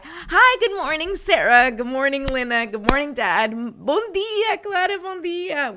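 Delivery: high, loud, singsong hellos; cheerful greeting. Real, not acted. Host greets friends joining her live stream, cheerful and high. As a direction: call out bright-pitched hellos to friends.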